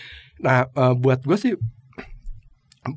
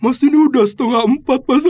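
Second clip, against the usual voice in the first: high, loud junior's protest; 12 semitones higher, 10 dB louder than usual. Retold or imitated. Junior voiced, anxious. (meniru junior yang panik, nada tinggi)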